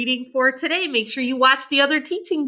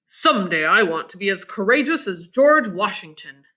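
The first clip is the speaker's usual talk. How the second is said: Abe, proudly; 5 semitones lower than usual. Acted. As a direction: lower voice, a touch of pride. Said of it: "said Abe proudly".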